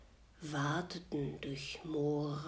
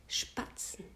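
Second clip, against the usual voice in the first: ordinary delivery, nothing marked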